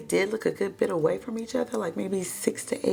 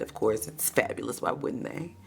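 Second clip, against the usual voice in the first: sassy murmur; 7 semitones lower, 5 dB quieter than usual. Real, not acted.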